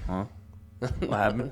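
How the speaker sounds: a hint of a puzzled dim hog; lightly voiced confused hog; clueless hog voice. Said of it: "the hog's just like".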